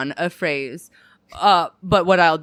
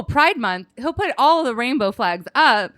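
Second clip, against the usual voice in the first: normal talking voice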